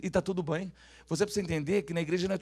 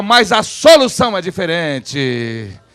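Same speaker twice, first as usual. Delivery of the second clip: slow, booming declaration; loud proclamation; 18 dB louder, much slower than usual. Shown as a demonstration. Preacher completes the line for the congregation to repeat, loud proclamation. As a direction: stretch the words out at full volume. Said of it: "Fala assim, ó".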